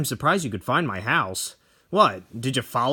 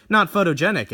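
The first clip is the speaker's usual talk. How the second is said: usual speaking voice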